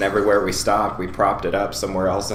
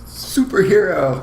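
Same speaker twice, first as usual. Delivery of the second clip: announcer shout; 8 semitones higher, slower than usual. Pretend. Announcing the segment.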